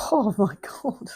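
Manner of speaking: tearful half-laugh; real teary exclamation; close to tears. Real, not acted. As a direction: gasp it out through tears and a laugh. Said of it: "I don't want my makeup to run".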